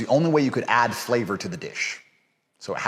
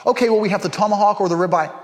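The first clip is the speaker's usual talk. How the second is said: higher, fast waiter patter; waiter listing options; 8 semitones higher, 4 dB louder, faster than usual. Retold or imitated. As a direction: rattle off the menu in a mocking high voice. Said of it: "they start talking about options".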